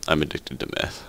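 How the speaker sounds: whispered confession; joking whisper. Pretend. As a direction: breathe it out as a joke. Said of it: "I'm sorry".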